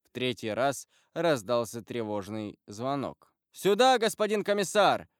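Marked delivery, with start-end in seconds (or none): 3.5-5.1 raised, higher-pitched call; officer calling out. A narrator's reading. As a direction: summon someone loudly, as a sergeant would. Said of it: "крикнул унтер-офицер".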